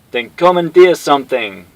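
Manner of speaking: loud high bark; barked order. Acted. Guile barking orders at Cammy, loud bark. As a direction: bark the command. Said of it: "Guile barked".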